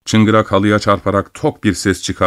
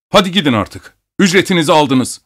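angry dismissal; 7 semitones higher than usual. A narrator's reading. Abogin angrily dismisses the doctor.

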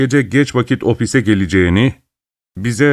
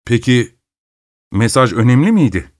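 ordinary delivery, nothing marked

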